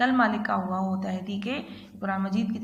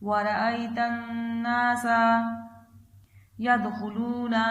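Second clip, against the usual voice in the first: slow, drawn-out tajweed chant, phrase by phrase; Quran recitation; slower than usual. Acted. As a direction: chant each phrase slowly for the child to repeat. Third recitation of the surah.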